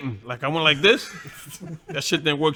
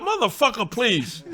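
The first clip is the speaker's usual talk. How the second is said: cocky retort; 7 semitones higher than usual. Retold or imitated.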